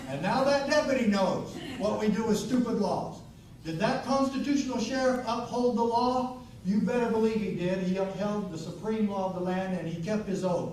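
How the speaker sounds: loud, high, forceful speech to a crowd; impassioned rally oratory. Acted. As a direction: drive it home like a fiery stump speaker. Rallies audience at full force.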